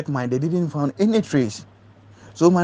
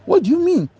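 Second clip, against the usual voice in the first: sudden high, louder what do you mean; incredulous outburst; 4 dB louder than usual. Retold or imitated. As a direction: blurt it out in disbelief. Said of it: "And I was like".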